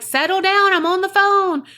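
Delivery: high, loud mock parent scolding. Dad on the phone to his noisy kids, mock parent voice. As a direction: imitate an exasperated parent calling out. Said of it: "that parent voice he used".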